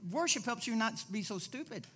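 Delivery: usual speaking voice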